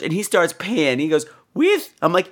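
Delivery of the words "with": imitated tiny high voice; high little questioning squeak; teeny tiny high voice. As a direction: mimic a tiny woman's high voice. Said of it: "little teeny tiny high voice".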